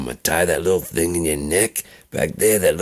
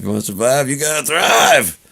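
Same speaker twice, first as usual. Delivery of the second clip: rising into a louder barked "thrive"; drill sergeant line; 9 semitones higher, 5 dB louder than usual. Shown as a demonstration. Quoting the sergeant's catchphrase, building to a bark. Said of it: "something the drill sergeant would scream".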